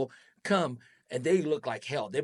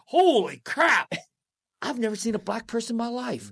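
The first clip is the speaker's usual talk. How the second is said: amazed exclamation; 9 semitones higher, 6 dB louder than usual. Retold or imitated. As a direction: gasp it like a kid seeing something new. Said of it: "I said".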